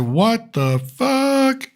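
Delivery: slow, drawn-out what-the-heck; wife's aghast reaction, stretched out; stunned. Retold or imitated. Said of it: "even she looked at it and said".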